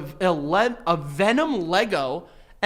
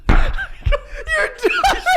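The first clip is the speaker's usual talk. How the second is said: high, breathy laughter through the words; can barely get it out for laughing; helpless laughing disbelief; 5 dB louder than usual. Real, not acted. Laughing at the absurd request.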